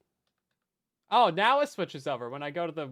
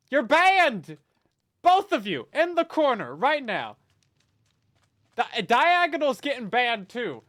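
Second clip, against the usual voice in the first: loud, higher playful scold; mock scolding; 5 semitones higher, 7 dB louder, slower than usual. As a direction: send them to the corner jokingly.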